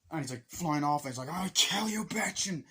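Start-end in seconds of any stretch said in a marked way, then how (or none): 1.3-2.5 high, hammed-up action-film threat; put-on angry cry, pitched up; mock furious threat. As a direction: imitate a fighter vowing revenge. Retold fight threat on jet.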